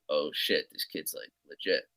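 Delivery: imitating the coach's low, soft awed murmur; hushed awe. Coach murmuring to himself about the new kid, hushed. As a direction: breathe it out like an amazed coach.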